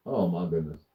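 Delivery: ordinary talk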